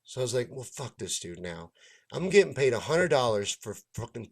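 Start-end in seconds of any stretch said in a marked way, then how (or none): 0.5-1.7 muttered disgust. Retold or imitated. Reaction to being nearly fired.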